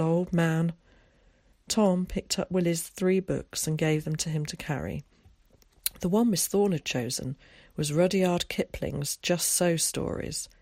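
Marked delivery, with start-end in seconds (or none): none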